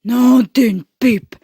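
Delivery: loud, slow Papa Bear growl; big growling voice. Acted. Papa Bear seeing his chair moved, big slow growl. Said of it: "il gronde de sa grosse voix".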